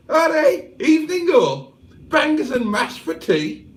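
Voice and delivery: hammed-up South Liverpool twang; Liverpool accent. Reading a Liverpool viewer's message in a hammed-up Scouse accent. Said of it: "'Cause Cheeseburger is from South Liverpool".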